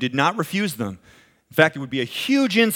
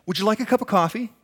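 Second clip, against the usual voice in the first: host offering coffee; 4 semitones higher than usual. Retold or imitated. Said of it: "the host comes out to you and says".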